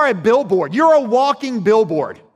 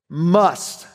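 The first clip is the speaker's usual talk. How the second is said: must leaned on, then a pause; stress on must; 4 semitones lower than usual. Shown as a demonstration. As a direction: hit the word must hard and let it hang. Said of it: "and I really emphasize must here".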